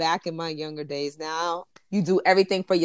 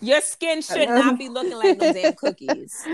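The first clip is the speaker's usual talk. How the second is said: laughing; 6 semitones higher than usual. Real, not acted. Laughing at cookie skin.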